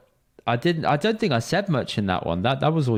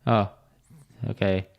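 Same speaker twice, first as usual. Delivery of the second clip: ordinary delivery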